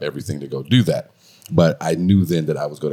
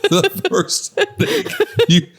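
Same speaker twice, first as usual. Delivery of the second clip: laughing; 14 semitones higher than usual. Real, not acted. Teasing wife while laughing.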